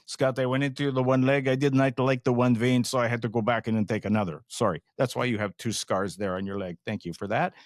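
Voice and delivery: imitating the French Canadian surgeon, a touch fast in places; playful imitation of a French Canadian doctor's accent. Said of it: "excuse my best, uh, French Canadian accent".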